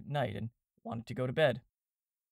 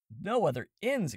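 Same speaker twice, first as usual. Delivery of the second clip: higher, drawn-out exclamation; dismayed exclamation; 6 semitones higher, 4 dB louder, slower than usual. A narrator's reading. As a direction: exclaim it in disbelief, slowly. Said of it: "exclaimed Harris".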